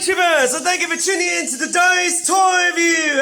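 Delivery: high, loud, hyped channel intro; loud YouTube greeting. Real, not acted. Host greeting YouTubers at the start of a review, hyped and loud. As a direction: belt out the welcome to viewers.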